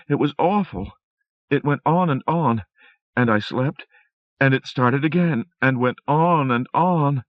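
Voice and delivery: lightly voiced frightened wife; Rosemary voice, shaken.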